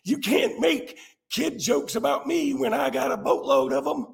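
high, breathy words broken by laughter; laughing through words. Real, not acted. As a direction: laugh while you protest. Laughing at the troll's joke.

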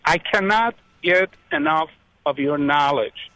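normal talking voice

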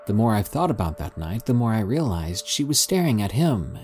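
usual speaking voice

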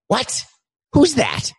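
high startled questions. Acted. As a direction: shoot up in pitch, caught off guard. Host startled by intruder.

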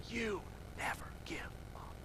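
intense, barely voiced urging; little inner voice. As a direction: whisper it like a voice in your head. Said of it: "I remember a little voice".

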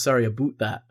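low-key demo of Canadian "about", rhyming with boot; Canadian "aboot". Shown as a demonstration. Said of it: "you actually messed that up".

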